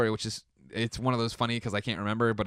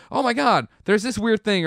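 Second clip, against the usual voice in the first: higher, louder mock alarm over a bump; mock panic; 9 semitones higher, 4 dB louder than usual. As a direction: play up your own overreaction, half joking. Retelling own health panic.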